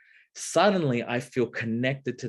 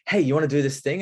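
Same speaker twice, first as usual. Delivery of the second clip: higher, louder keen ask; eager invitation; 5 semitones higher, 6 dB louder, faster than usual. Retold or imitated. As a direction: pitch it brightly and hope. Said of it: "'cause I'm like".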